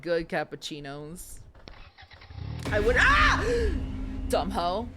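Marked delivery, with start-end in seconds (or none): 2.7-3.4 sudden high startled yelp mid-sentence; real jump-scare fright, voice shoots up and louder; startled cry. Real, not acted. 3.5-4.2 sharp high gasp of fright; a real shocked intake of breath; startled gasp. Real, not acted. Jump scare in the game.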